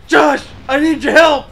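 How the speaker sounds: mock panicked yell; urgent call. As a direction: call out to a brother from another room. Retold brother calling out for help.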